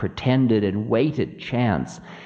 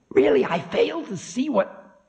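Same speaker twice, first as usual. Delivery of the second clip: high-pitched huffy objection; high, put-out figure of Time; 14 semitones higher than usual. Acted. Time objects, gets cut off.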